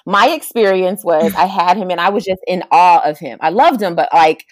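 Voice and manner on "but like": ordinary delivery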